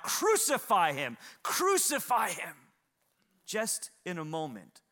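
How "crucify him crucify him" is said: loud mob demand, rising on the repeat; crowd crying out. Acted. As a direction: cry out like a turned crowd. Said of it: "began to cry out".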